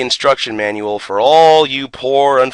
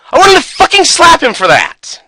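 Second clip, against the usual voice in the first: much higher and louder, furious outburst; exasperated shout; 7 dB louder than usual. Real, not acted. Ranting to viewers about his friend's blunder, exasperated shout. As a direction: blow up at the memory.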